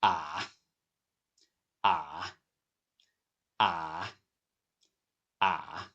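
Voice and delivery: "Ah" dipped low, then rising; low, soft, scooped "Ah"; third tone. Shown as a demonstration. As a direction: let each "Ah" sink to the bottom and climb back. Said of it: "goes down to the bottom and then comes up".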